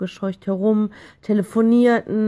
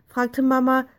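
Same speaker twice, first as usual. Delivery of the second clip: ordinary delivery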